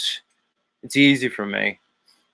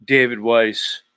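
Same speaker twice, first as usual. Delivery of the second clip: ordinary delivery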